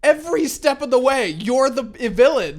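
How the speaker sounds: high, loud, drawn-out mock outrage; hammed-up scolding, stretched out for laughs; outraged rebuke. Scolding the villain of the story, mock outraged.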